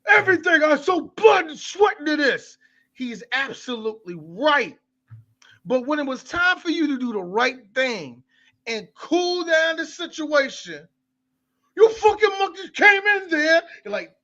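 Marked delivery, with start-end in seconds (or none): 0.1-2.6 higher, louder, proud owner's plea; imitating Sal. Quoting Sal claiming the shop he built, higher and pleading. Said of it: "And Danny Aiello, despite Sal being". 11.8-13.6 high, loud sneering voice; mock outraged rant. Mocking the cops rushing into the scene, high and loud.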